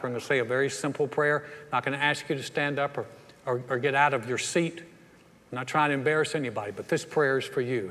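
ordinary talk, nothing marked

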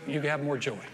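ordinary delivery

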